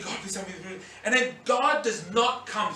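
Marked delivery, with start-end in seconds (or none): 0.0-0.8 much softer pleading prayer; quiet plea. Retold or imitated. Jesus silently praying on the cross, quiet plea. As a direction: whisper a plea for help. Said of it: "Jesus probably would have been thinking".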